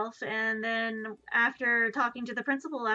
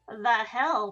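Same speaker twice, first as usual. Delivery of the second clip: louder, baffled retort; loud disbelief; 8 dB louder than usual. Retold or imitated. Reacting to classmates, loud baffled retort. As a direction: bark it out in disbelief. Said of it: "I was just like".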